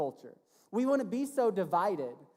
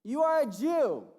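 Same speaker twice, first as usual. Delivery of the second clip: 1 surprised; 7 semitones higher, 6 dB louder, slower than usual. Acted. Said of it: "She said to Jesus".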